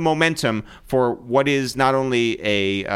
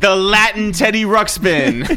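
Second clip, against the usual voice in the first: hype intro; 9 semitones higher, 6 dB louder than usual. Acted. Hyped intro of guest.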